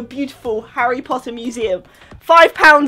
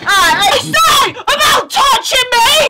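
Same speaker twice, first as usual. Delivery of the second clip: high, loud mock-crying shouts at a Dementor toy; hammed-up fight; 10 semitones higher, 5 dB louder, slower than usual. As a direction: play a kid wailing in a toy brawl.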